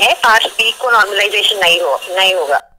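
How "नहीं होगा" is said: ordinary talk, nothing marked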